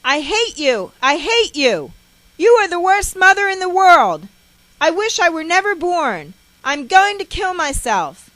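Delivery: put-on high, very loud child's outburst; all-out anguished tantrum; hysterical scream. A narrator's reading. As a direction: scream hatred and despair at mother. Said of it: "I scream".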